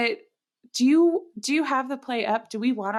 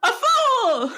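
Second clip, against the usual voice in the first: high, loud, hammed-up exclamation; mock insult; 12 semitones higher, 5 dB louder than usual. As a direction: fling it out as a theatrical punchline. Acted insult ending the scene.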